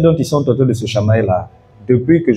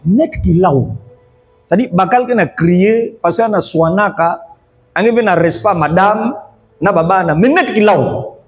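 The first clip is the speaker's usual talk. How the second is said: higher, mocking husband yelling at wife; shouting husband; 7 semitones higher than usual. Pastor imitates the husband scolding his wife, raised mock yell.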